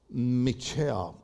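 MICHELLE stretched slowly, English style; careful English Michelle. Retold or imitated. Pastor recalls a church sister's name, careful English Michelle. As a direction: say MICHELLE low and drawn out, with an English vowel. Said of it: "Repeat after me, Michelle".

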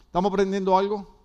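ordinary talk, nothing marked

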